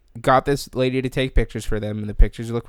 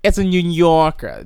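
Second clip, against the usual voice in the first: loud, higher, slow hammed-up New York accent; bad New York accent; 6 semitones higher, 8 dB louder than usual. Shown as a demonstration. Host showing off a poor New York accent to friend, hammed-up New York accent. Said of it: "That's my New York accent".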